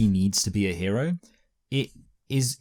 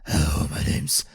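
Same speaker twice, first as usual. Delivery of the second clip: low, slow put-on voice; disguised voice; 5 semitones lower than usual. Co-host joking how Peter hides his identity, deep disguised voice. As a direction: drop deep and drag the words out to hide who you are. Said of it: "he talks like this".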